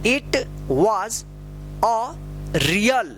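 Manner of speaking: slow reading with a long pause after each word; deliberate, isolated words, firmly pronounced; word-by-word model reading. Shown as a demonstration. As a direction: read each word out separately for learners to repeat.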